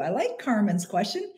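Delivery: ordinary talk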